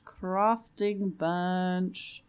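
"Crafting bench" stretched very slowly; drawn-out. Real, not acted. Player calling for a lost item, playfully drawn-out. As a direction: playfully drag out both words of "Crafting bench".

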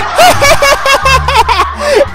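high burst of laughter. Acted. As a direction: cackle at the fools. Girl laughs at the rivals.

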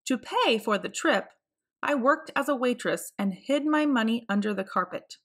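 usual speaking voice

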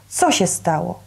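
ordinary delivery, nothing marked

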